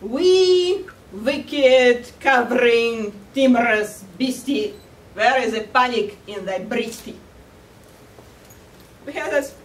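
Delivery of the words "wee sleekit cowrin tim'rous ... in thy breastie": loud, declaiming Scottish wolf; Scottish brogue, opening loud and drawn out; Scottish accent. Acted. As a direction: recite it grandly with a Scottish accent. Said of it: "the wolf has a Scottish accent".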